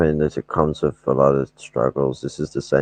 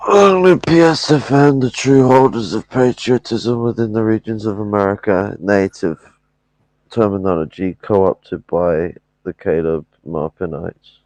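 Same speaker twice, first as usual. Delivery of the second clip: high, loud rally shout; fiery revolutionary speech; 6 semitones higher, 5 dB louder than usual. Pretend. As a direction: proclaim it like a rally chant. Sign-off shouting revolutionary slogans.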